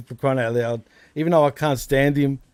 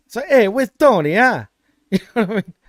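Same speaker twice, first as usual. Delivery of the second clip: higher-pitched mock Italian-American accent; Italian-American wise guy; 5 semitones higher, 4 dB louder than usual. Co-host imitating wise guys from Italy, mock Italian-American accent. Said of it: "are total wise guys".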